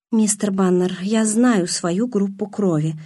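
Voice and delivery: ordinary delivery, nothing marked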